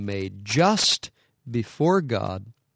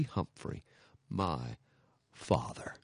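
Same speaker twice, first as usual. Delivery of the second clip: hushed solemn close; 6 semitones lower, 8 dB quieter, slower than usual. Retold or imitated. Reading monument inscription, solemn.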